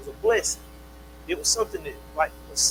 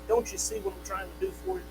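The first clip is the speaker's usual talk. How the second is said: pleading; 11 semitones higher, 8 dB quieter, faster than usual. Acted. God pleading with his children.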